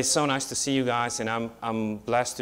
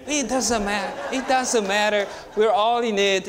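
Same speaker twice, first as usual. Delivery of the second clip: much higher and building louder, full of joy; excited, rising preaching; 10 semitones higher, 4 dB louder than usual. Retold or imitated. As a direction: carry them into the happy reunion, voice climbing. Joyful homecoming with family retold.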